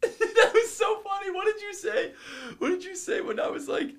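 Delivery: breaking up laughing mid-question; laughing hard. Real, not acted. Recalling the funny talk with his friend, cracking up.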